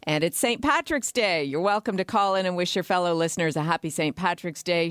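ordinary talk, nothing marked

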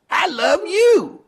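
very high, slow, mock-tearful; mock sobbing declaration. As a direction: wail it like a fan overcome with tears. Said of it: "runs over to Donald Trump and says".

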